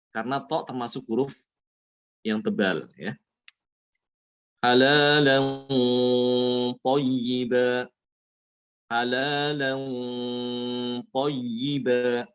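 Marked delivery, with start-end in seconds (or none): 4.6-12.3 slow chanted "Halalan" ending in heavy hum; thick ikhfa. Shown as a demonstration. Teacher recites example for ta, thick held nasal. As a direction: hold the nasal of "Halalan" before "thayyiba". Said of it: "gunanya ikut tebal".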